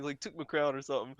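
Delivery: ordinary talk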